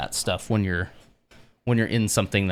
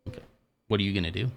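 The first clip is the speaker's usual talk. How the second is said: usual speaking voice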